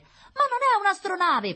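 put-on very high, loud, drawn-out child voice; protesting little girl. A narrator's reading. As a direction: object like an exasperated child. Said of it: "protestò Rita". (protesta acuta da bambina)

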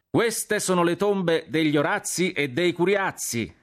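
high, slow, carrying shout; shouted announcement. A narrator's reading. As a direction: proclaim it slowly to the whole column. (annuncio gridato, acuto e scandito)

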